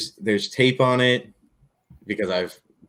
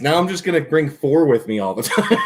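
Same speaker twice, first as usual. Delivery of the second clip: laughing; 5 semitones higher than usual. Real, not acted. Laughing shout.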